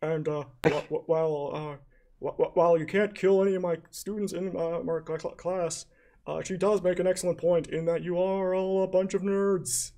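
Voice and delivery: high, stammering comic teacher; Robin Williams-style teacher. Acted. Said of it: "in a Robin Williams-esque routine".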